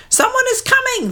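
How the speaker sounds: a small bird's fast alarm; mud hen crying out. Acted. Leader warning the flock, high quick bird cry. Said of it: "the leader cried".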